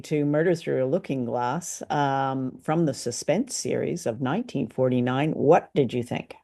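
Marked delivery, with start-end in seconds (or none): none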